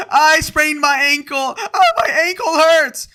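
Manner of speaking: slow, high, loud wail; mock whining player. Streamer mocking Mac Jones's injury, wailing in fake agony. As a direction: wail like a hammed-up injury victim.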